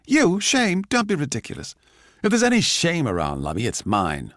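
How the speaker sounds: high, furious scornful retort; wild with rage. Acted. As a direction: spit it back at him in rage. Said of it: "wild with rage".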